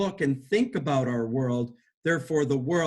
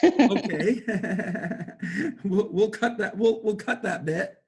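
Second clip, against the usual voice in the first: giggling, high and bright; laughing; 5 semitones higher than usual. Real, not acted. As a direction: laugh through the words. Laughing over cut that bit out.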